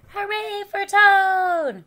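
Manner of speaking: high, loud, drawn-out hooray; shouted cheer. A narrator's reading. Frog cheers Toad on stage, shouted. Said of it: "as he shouted".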